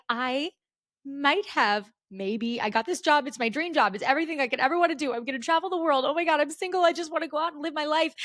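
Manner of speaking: high, rushed nervous babbling; frantic confession. Retold or imitated. Confessing to the nurse in a high nervous rush. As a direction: spill it all out in a panic.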